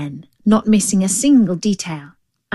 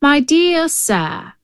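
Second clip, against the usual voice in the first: higher, slow, condescending address; lofty storybook figure; talking road; 8 semitones higher, slower than usual. Acted. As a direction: address him with slow, patronising politeness.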